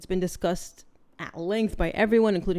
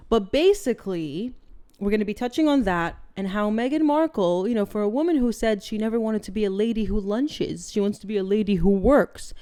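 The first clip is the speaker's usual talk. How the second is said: ordinary talk, nothing marked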